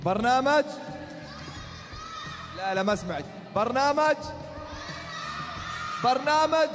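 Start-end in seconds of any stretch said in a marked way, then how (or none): 0.0-0.7 high, loud crowd call; hype call. Acted. Host hyping crowd response. 3.5-6.7 high, shouted crowd call; hype shout. Acted. Repeating louder to audience.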